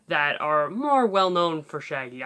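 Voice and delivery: normal talking voice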